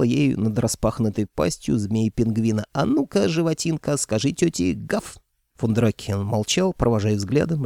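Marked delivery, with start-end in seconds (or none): 2.7-5.2 put-on higher cooing baby talk. A narrator's reading. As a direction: coo at it like a baby. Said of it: "засюсюкала".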